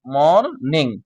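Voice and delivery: মর্নিং split as মর, নিং; teacher's two-beat মর্নিং; two syllables. Shown as a demonstration. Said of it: "দেখো এখানে দুইটা syllable".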